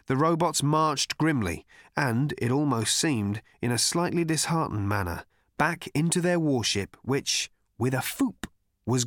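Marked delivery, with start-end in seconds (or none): none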